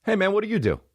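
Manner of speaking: fake-casual, fishing for status; mock friendly probing. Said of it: "and he says".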